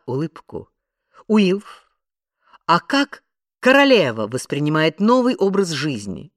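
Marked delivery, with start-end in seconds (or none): 1.3-6.3 high, loud presenter question; put-on interviewer brightness; forced interviewer cheer. A narrator's reading. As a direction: pitch it up like a TV reporter. Reporter forcing a bright interview question.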